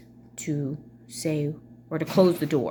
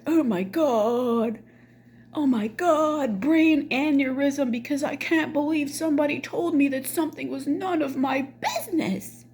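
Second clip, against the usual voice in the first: mock outrage; 9 semitones higher than usual. Mother who can't believe she was rebuffed, mock outraged. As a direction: wail it like a scandalized drama queen.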